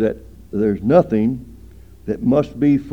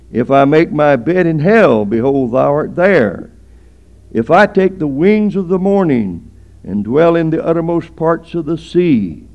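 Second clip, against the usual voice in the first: loud, measured, declaimed psalm; scripture recitation; 2 semitones higher, 7 dB louder than usual. Real, not acted. Preacher reading a psalm on God's presence to the congregation, loud scripture recitation. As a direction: proclaim each verse with weight and force.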